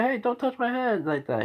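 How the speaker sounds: high-pitched, embarrassed mock protest; flustered protest. Girl whose head just got ruffled, high flustered protest. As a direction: squeal it, flustered, as if blushing. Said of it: "she's blushing and say".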